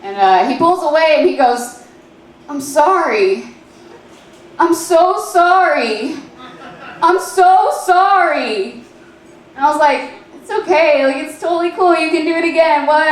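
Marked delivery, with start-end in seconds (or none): 2.5-8.8 high, drawn-out hammed-up apology; mock anguished apology. Boyfriend apologizing after panicking in the dorm room, mock anguish. 10.4-13.0 mock reassurance. Acted eager reassurance to boyfriend.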